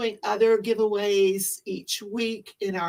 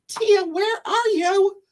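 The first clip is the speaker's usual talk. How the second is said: high, loud, drawn-out call; 10 semitones higher, 6 dB louder than usual. Real, not acted. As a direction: call across the room for her. Calling out for co-host.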